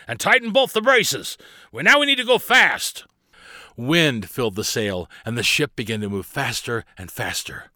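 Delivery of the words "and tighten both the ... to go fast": urgent raised-pitch command, loud; shouted order. A narrator's reading. Barnabas needs the ship to go fast, shouting urgently. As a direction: shout orders to get the crew moving. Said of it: "Barnabas shouted".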